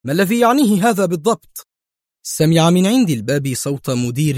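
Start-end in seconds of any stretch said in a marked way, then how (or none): 0.1-1.7 high, fast agitated demand; edgy and rattled; tense, sharp question; nervous irritation. A narrator's reading. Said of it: "ثم أضاف في عصبية".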